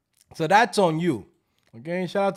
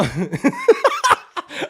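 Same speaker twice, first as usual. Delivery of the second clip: high laugh; 6 dB louder than usual. Real, not acted. Laughing hard.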